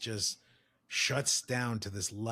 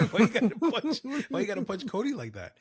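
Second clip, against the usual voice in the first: laughing mock question; 11 semitones higher, 4 dB louder, faster than usual.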